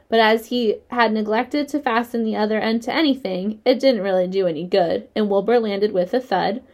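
ordinary delivery

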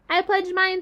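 high, tiny little voice; small spider voice. Acted. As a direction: squeak it like a baby spider. Joy the spider speaks.

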